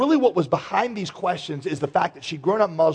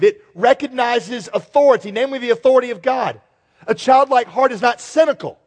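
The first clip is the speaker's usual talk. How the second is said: preaching; 7 semitones higher, 5 dB louder than usual. Acted. Preaching at full force.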